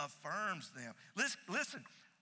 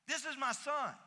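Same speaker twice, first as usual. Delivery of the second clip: This is my son said higher and fuller; higher restatement; 8 semitones higher than usual. Shown as a demonstration. Pastor repeating God's plain words, higher. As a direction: lift it up the second time. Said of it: "God could have simply said".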